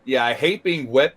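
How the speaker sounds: ordinary delivery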